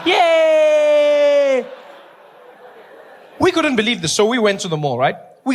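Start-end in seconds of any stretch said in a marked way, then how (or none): 0.1-1.6 high, loud excited cheer; a crowd squealing with delight; mock cheer. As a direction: shout it like a thrilled crowd.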